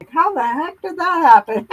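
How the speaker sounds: playfully puzzled at your own mishap; retold bafflement. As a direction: act baffled, pitch lifted. Retelling waking to botched crochet.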